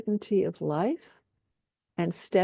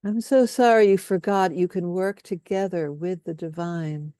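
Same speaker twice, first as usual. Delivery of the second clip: guided compassion statements. Acted. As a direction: lay each statement down gently, leaving room for echoes. Leads group in compassion statements.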